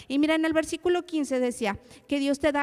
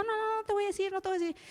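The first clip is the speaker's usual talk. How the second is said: put-on stubborn child refusing; 9 semitones higher, much faster than usual. Daughter refusing to reveal the hidden keys to mother, imitated high childlike voice. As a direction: imitate a small girl digging in, pitched well up.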